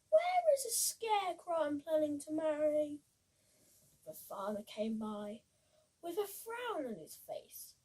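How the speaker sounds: put-on lively read-aloud for children; bouncy rhyming picture-book voice; storybook reading. A narrator's reading.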